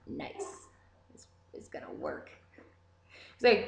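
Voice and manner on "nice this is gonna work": whispered aside. Retold or imitated. Sly aside about cop and sister.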